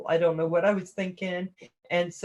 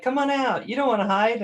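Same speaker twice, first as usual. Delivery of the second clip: high coaxing dog voice; playful higher voice for the dogs; 5 semitones higher, 3 dB louder, faster than usual. Retold or imitated. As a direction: coax her out like the dogs would. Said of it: "'Cause they're like".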